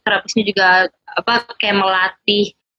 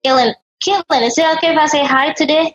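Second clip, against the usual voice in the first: higher-pitched, imitated greeting to a child; friendly neighbour; 7 semitones higher than usual. Elderly neighbour asking a child for a hello, imitated friendly greeting. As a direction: coax gently with a lifted pitch. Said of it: "mereka gini".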